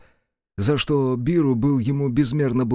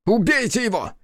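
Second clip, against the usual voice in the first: high, slowing rage; furious shout; 11 semitones higher than usual. A narrator's reading. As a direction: yell in blind fury. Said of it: "закричал Хицу".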